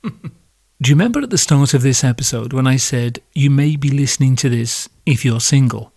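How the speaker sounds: ordinary delivery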